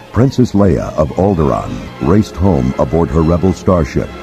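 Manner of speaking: usual speaking voice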